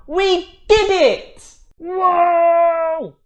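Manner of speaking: high, loud triumphant shout; excited cheer. Acted. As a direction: celebrate with a big whoop. Celebrating reading the word.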